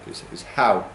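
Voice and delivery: usual speaking voice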